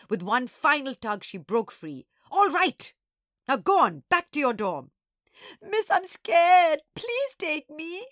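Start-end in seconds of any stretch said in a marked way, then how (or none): 2.3-4.9 loud, exasperated command; forceful, higher-pitched scolding; stern order. A narrator's reading. Teacher orders child back to dorm. 5.6-8.1 high, very slow tearful wail; drawn-out, hammed-up whimpering plea; scared wailing. A narrator's reading. Child pleads tearfully with teacher.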